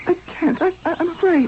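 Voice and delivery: high, scared voice; frightened. Acted. Young Akhenaten tells the general he's afraid, high and scared. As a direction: shrink back, too afraid to shoot. Boy too scared to fire.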